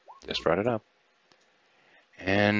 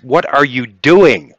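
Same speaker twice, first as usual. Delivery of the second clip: high, loud, drawn-out challenge; raised, incredulous in-character shout; alarmed protest; 8 semitones higher, 9 dB louder, slower than usual. Acted. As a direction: call out to stop someone mid-swing, slowly. Game master voices alarmed Kiva mid-swing.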